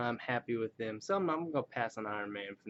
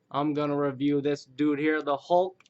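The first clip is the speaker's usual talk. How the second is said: ordinary talk, nothing marked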